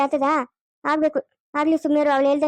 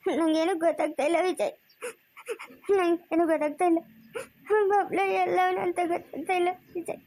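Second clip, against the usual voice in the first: helpless tearful lament; mother weeping with regret; crying; 2 semitones higher than usual. Acted. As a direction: sob between halting phrases. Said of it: "ನೀನ್ ಅಳ್ಬೇಡ ಸುಮ್ನೆ ಇರು".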